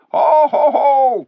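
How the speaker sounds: high, loud ho ho ho laugh; mocking ape laugh. A narrator's reading. The ape laughing at the dwarfs' doubt, high and loud. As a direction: laugh it out to mock them. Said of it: "cried the ape".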